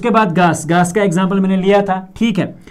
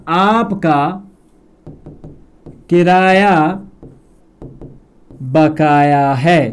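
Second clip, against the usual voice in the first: slow dictation; slower than usual. Shown as a demonstration. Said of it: "ध्यान रखिएगा".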